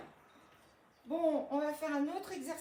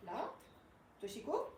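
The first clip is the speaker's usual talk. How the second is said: ordinary talk, nothing marked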